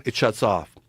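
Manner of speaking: normal talking voice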